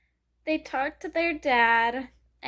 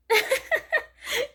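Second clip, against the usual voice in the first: higher delighted giggle; 9 semitones higher than usual. Real, not acted. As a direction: let out a squealing laugh. Reacting to the ending.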